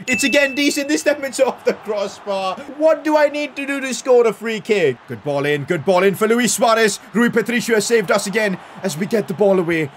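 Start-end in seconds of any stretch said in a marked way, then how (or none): 0.1-5.0 high-pitched, frustrated disbelief; exasperated outcry. Real, not acted. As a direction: cry out at another near miss. Second free kick hits crossbar.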